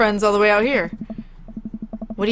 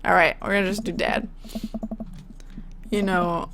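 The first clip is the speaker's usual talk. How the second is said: usual speaking voice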